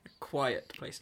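"Quiet" in two distinct syllables, kwy-et; "Quiet" with both syllables sounded; corrective pronunciation. Shown as a demonstration. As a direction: model "Quiet" carefully as kwy-et. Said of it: "Not quite".

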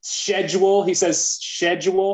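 schedule starting with "sh"; British schedule. Retold or imitated. Recalling Uncle Paul's words, schedule as "SHED-yool". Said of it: "say with a British accent".

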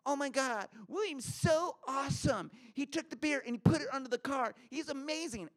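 put-on gushing girl. Teresa gushing over the narrator's trick, high and excited. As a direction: swoon over him in a high voice.

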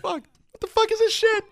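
very high, slow shriek; mock outraged heckler. Echoing the heckle, a very high mock shriek. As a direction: squeal it like a fed-up heckler. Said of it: "I'm like".

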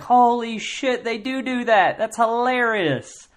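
amazed recognition. Retold or imitated. Family member recognizing mom's quirk, amazed. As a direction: gasp at a spot-on mannerism. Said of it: "'cause you're like".

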